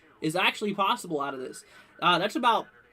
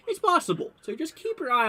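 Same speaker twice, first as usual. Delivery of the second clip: rising emphasis; 10 semitones higher, 4 dB louder than usual. Real, not acted. Warning viewers of storms.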